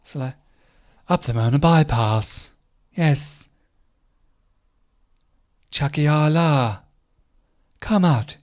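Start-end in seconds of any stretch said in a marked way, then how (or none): 1.1-8.4 lightly mocked robotic Alexa. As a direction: read it like a smart speaker. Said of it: "Alexa's very sort of like".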